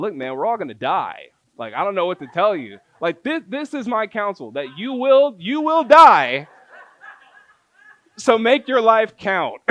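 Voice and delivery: hammed-up loud laughing verdict; blunt mock counsel. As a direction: deliver bad advice with a loud laughing flourish.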